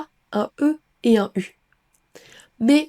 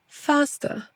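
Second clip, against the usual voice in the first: British accent; 4 semitones higher, 5 dB quieter than usual. Shown as a demonstration. Drill alternates American and British.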